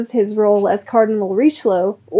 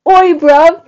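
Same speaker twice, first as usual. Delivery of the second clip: high, loud, hammed-up British Oi bruv; mock British accent; 7 semitones higher, 6 dB louder than usual. Joking with co-host, a hammed-up British accent. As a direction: shout it like a cartoon Londoner. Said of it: "British accents".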